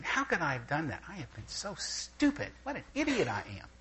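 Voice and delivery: quiet, hurried berating of yourself; self-critical mutter. Retold or imitated. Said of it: "constantly saying".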